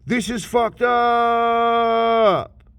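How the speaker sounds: high, loud, drawn-out complaint; slow, high, loud griping; fed-up whine. Retold or imitated. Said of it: "You're like".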